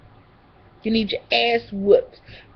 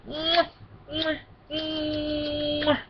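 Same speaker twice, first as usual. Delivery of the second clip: soft "mwah" kiss sounds; blown kisses; 5 semitones higher, 10 dB quieter, much slower than usual. Real, not acted. Vlogger signing off to viewers, blown kisses. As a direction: smack soft kisses, last one drawn out.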